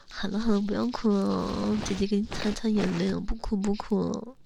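soft, cooing comfort to someone crying; coaxing, softened voice drying someone's tears; tender soothing. Acted. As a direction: hush them gently, like a doting older sister. Comforting a crying younger boyfriend.

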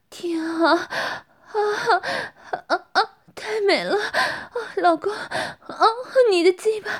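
high, loud moaning voice; wife moaning in pleasure. Acted. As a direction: gasp and cry out between words. Wife character in intimate scene. (高声娇喘呻吟)

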